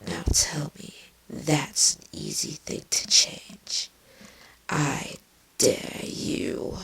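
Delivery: menacing breathy whisper, barely voiced; dangerous whisper. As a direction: hiss the threat under your breath. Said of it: "Her voice became a dangerous whisper".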